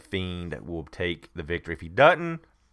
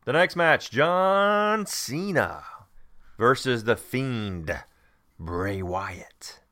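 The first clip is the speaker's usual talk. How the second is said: big drawn-out announcer intro, high then dropping; ring announcer; 4 semitones higher, much slower than usual. Pretend. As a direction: stretch the name out like a ring announcer. Announcing the next match.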